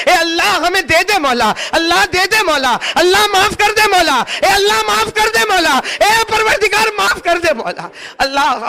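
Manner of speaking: building from earnest to high, loud crying plea; tearful pleading prayer. Real, not acted.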